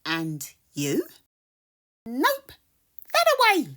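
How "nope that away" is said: high, loud helpful critter; animal voice. Acted. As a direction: point the way brightly. Animal points the way.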